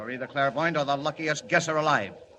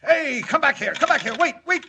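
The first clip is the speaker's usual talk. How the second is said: high, loud call into the phone; shouting after caller; 11 semitones higher, 5 dB louder than usual. Acted. Editor yells into the phone after the hanging-up caller. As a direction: yell for him to stay on the line.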